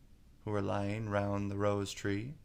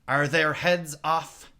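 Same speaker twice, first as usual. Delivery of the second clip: higher, louder, slow demand; shouted; 6 semitones higher, 10 dB louder, slower than usual. A narrator's reading. Queen shouts to the soldiers. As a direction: bellow across the garden. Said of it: "shouted the queen".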